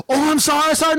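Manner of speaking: high-pitched mimicked worker; imitated apology. Taco worker apologizing to angry customer, mimicked. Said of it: "She goes".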